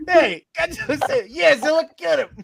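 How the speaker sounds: high, laughing cheer for Godzilla; root for the monster, high and giddy; cheering fan. Pretend.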